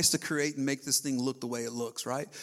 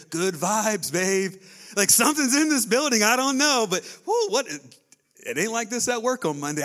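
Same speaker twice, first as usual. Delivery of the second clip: loud, higher, wide-eyed churchgoer; amazed newcomer; 5 semitones higher, 6 dB louder than usual. Acted. Visitor walking into the church, loudly amazed. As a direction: burst in stunned by the presence. Acted visitor feeling God's presence.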